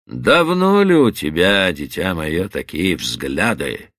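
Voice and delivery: suddenly calm, holding back; calm and even. Acted. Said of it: "неожиданно спокойным и ровным голосом".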